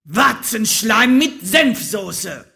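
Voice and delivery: angry shout. Acted. Raging wizard cursing in his kitchen, angry shout. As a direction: shout each word of the curse, drawn out. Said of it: "schrie er".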